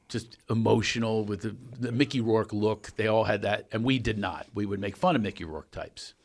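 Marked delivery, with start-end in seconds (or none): none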